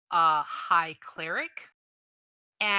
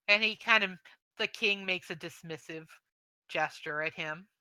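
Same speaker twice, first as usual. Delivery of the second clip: ordinary delivery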